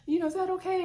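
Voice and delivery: put-on high sugary girlfriend voice; Mel on speakerphone. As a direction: chirp it high like a friend on the phone. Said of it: "Mel's like".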